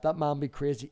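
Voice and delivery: ordinary talk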